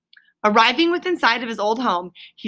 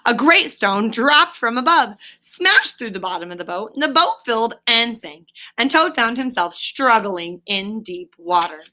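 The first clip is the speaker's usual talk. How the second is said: ordinary delivery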